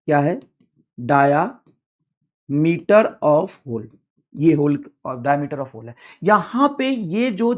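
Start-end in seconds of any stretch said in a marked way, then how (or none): 1.0-3.9 dictation. Shown as a demonstration. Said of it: "dictating term".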